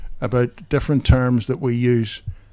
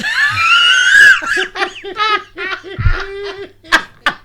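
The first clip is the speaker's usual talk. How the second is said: very high, loud laughter; real laughter, high and loud; shrieking laugh; 7 dB louder than usual. Real, not acted. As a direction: let the laugh take over. Laughing at own story.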